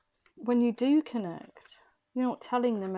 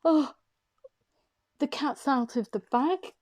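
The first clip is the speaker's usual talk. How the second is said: loud, raised exclamation, as if a secret is out; anxious outburst; 5 semitones higher, 6 dB louder than usual. Acted. Tarot reader channeling someone's anxiety, anxious outburst. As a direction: blurt it out in alarm, higher and louder. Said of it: "It's kind of like an anxious".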